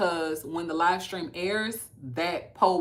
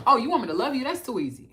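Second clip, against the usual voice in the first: mock cocky; 6 semitones higher, 6 dB louder, faster than usual. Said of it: "I don't have to say".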